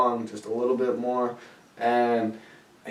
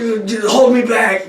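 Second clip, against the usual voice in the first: mock fighting words; 12 semitones higher, 8 dB louder than usual. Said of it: "I'm like".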